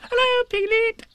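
very high squeak; squeaky Roo. Acted. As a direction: squeak it out, tiny and eager. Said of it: "he squeaked".

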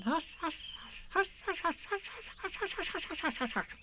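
tiny high soft hushes in a rush; hasty hushes. Acted. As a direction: everyone hushing down the line. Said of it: "They all said hastily".

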